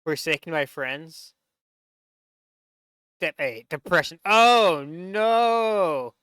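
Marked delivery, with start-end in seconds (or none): 4.2-6.1 loud, high oh no; mock horror at the cartoon; loud mock-shocked oh no; dismayed cry. Real, not acted. Reacting to the depression step.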